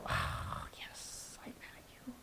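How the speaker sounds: barely audible whispered admission; sigh, then whisper it as if owning up reluctantly; whispered confession. Retold or imitated. Wife admitting to husband she is mad, in a sheepish whisper.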